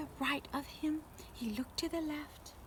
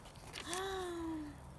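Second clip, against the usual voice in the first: sharp soft gasp of surprise; breathy storyteller gasp; surprised gasp; 5 semitones higher, 7 dB quieter than usual. Acted. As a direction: gasp at the sight. Sees monkeys in tree.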